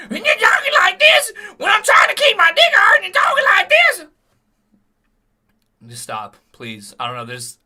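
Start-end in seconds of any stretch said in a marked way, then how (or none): 0.1-4.1 screeching falsetto yell, much higher and louder; mock squawking old woman; shrill screech. Shown as a demonstration. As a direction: shriek like a strangled bird.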